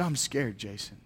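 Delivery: soft, low, understated fear; retold scared confession. Scared student confides in youth pastor, subdued fear. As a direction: quietly imitate a frightened caller, letting the name drop. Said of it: "he began to cry".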